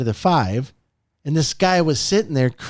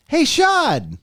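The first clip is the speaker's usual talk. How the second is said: bright and much higher, notes lifted; excited greeting pitched way up; 11 semitones higher than usual. Shown as a demonstration. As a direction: greet him like you can't wait to see him. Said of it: "I'm excited now".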